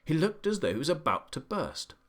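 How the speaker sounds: ordinary delivery, nothing marked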